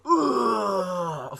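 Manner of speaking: long falling retching "ugh"; high, sliding vomit noise; imitated barfing. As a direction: mimic throwing up with a drawn-out groan. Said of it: "he fucking barfs up chocolate".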